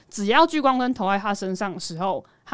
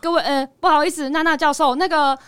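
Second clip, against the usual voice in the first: a bit louder and higher, like a student presenting; mock class presentation; 7 semitones higher, 6 dB louder than usual. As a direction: address the professor formally, half joking. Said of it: "在上课然后在报告的感觉".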